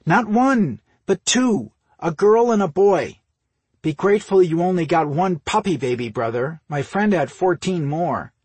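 high, loud, emphatic tally; pointed scolding. A narrator's reading. Sister listing her brother's offences, scolding. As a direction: punch out each count indignantly.

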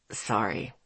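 very low, soft apology; hushed deep man's voice; rich baritone. A narrator's reading. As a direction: drop deep and quiet. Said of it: "in that rich baritone that I loved".

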